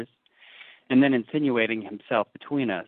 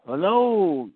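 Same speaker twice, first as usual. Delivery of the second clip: high hailing cry across the wharf; old sailor hailing the departing pair; calling out; 9 semitones higher, 6 dB louder than usual. A narrator's reading. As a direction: call out after them, high. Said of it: "cried stationary Elijah".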